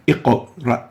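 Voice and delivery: "iqra" slowly, q bounced before the ra; "iqra" as iq-uh, ra, with vibrating q; iqra with qalqala. Shown as a demonstration. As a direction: split "iqra" at a strongly released q. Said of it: "and not a simple iq".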